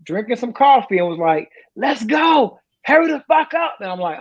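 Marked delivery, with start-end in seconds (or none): 1.8-3.8 high-pitched mock recruiter holler. Recruiter in a chair to exercising applicant, mock hollering. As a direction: call it out like a recruiter urging from his chair.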